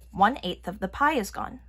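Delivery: ordinary delivery, nothing marked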